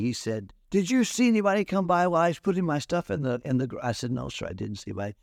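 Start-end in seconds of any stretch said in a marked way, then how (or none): 0.7-3.8 high, fast urgent questions; agitated questioning. Retold or imitated. Truck owner pressing the preacher for a witness, high and fast. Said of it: "he didn't blame me". 4.1-5.1 nervous. Retold or imitated. Nervous reply to irate man.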